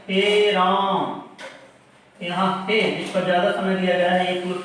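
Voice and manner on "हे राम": हे drawn out very long like a call; calling out हे राम. Shown as a demonstration. Teacher demonstrating how people call out, हे drawn out. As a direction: stretch हे in हे राम as if calling to someone. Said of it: "जैसे आवाज लगाते हैं".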